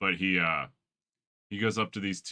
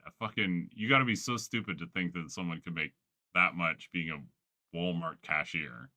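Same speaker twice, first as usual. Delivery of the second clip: normal talking voice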